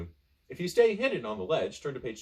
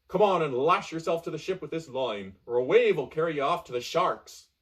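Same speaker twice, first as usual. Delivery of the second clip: louder, higher pirate command; warning pirate; 4 semitones higher, 4 dB louder than usual. Acted. Pirate orders the castaway to lash in, loud. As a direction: bark a warning over the waves. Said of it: "says the pirate".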